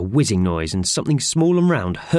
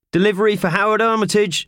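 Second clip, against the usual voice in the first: high, loud postman's announcement; announcing; 13 semitones higher, 4 dB louder than usual. A narrator's reading. Postman announcing a delivery to the office, loudly. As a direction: call it out like a postman. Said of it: "announced the postman".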